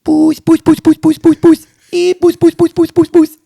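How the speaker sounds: loud, very high-pitched rapid repetition; mock cat-calling. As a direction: call a cat in piercing falsetto. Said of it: "And he just went".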